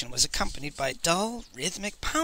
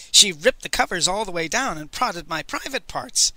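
usual speaking voice

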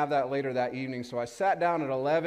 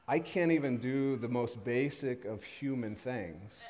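ordinary talk, nothing marked

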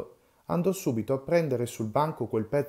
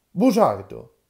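loud, higher shouted insult; angry shout; 6 semitones higher, 10 dB louder, slower than usual. Acted. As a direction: spit the word as an insult. Old men quarrelling again.

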